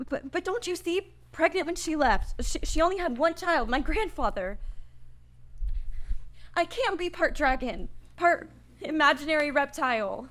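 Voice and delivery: a frightened protest that cracks into a laugh; panicked disbelief. Acted. Chris reels at the news.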